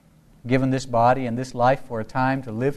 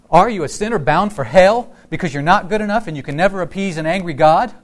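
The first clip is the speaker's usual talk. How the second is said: loud, raised preacher's challenge; forceful accusing question; 5 semitones higher, 6 dB louder than usual. Acted. Pastor voices condemnation to congregation, loud challenge.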